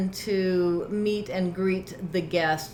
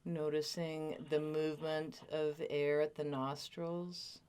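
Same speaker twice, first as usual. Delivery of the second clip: guided meditation; 2 semitones lower, 12 dB quieter than usual. Real, not acted. Teacher settling a group into body awareness, guided meditation. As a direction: gently lead listeners into stillness, leaving space between phrases.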